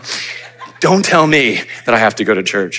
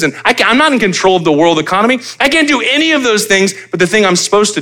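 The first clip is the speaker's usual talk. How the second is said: louder, higher preacher's build on each line; rising sermon fervour; preaching at full force; 8 semitones higher, 4 dB louder than usual. Acted. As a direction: drive it home from the pulpit. Preaching climax on control.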